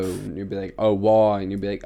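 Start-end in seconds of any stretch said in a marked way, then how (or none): 0.8-1.4 loud mock British reality-show contestant; British accent. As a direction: ask it loudly in a put-on British accent. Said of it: "that was an amazing, um, accent".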